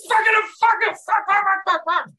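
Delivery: the clean-cut ballplayer finally snapping; furious outburst. Retold or imitated. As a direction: finally lose it and explode in rage. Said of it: "just lost it".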